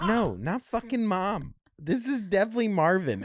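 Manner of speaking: high, loud, laughing insistence; excited outburst. Retold or imitated. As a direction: blurt it out, laughing and eager. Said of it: "And I was like".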